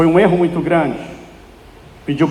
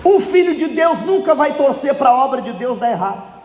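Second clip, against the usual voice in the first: fervent preaching; 8 semitones higher than usual. Real, not acted. Preacher urging the church to think like sons, fervent preaching. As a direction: preach it in long, driving, ringing phrases.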